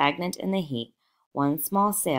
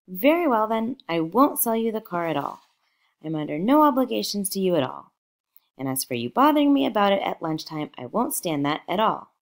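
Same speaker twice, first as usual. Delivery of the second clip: higher, louder and fast, short-tempered; annoyed, raised voice; 5 semitones higher, 4 dB louder than usual. A narrator's reading. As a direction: snap it out, fed up with the caller. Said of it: "The voice in the hall rose high with annoyance".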